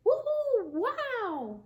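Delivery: high, breathy burst of glee; excited cheer. Acted. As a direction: whoop with joy. Otter cheers on meeting narwhal.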